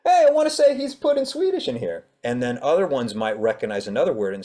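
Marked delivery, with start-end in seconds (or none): 0.0-2.0 high, loud delighted discovery; mock excited reader. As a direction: squeal it like a reader spotting Swedish. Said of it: "be like".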